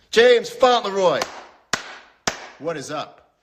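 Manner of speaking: high, loud, slow drawn-out greeting; calling out. Acted. Host greets [name], loud drawn-out call. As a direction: hail him like a big arrival.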